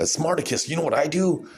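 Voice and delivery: high, eager voice; mock questioner. Fan asks Smarticus his method, lightly voiced high eagerness. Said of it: "playing different roles here".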